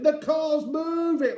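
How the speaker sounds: put-on very high, stretched child's shout. A narrator's reading. Eddie in the moving car, high shouting. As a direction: shout it high and slowly in alarm. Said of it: "Eddie is shouting".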